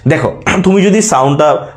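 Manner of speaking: ordinary talk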